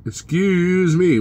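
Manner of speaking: drawn-out, high, put-on indignation; mock offence. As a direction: stretch it out as if scandalised. Mock offence at chat remark.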